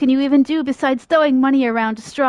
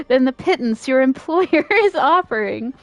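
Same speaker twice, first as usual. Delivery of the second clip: ordinary delivery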